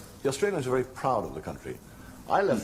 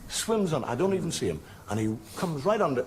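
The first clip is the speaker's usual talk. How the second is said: normal talking voice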